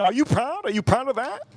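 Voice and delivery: high, incredulous mock question; lightly voiced doubting viewer. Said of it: "Some of you probably think".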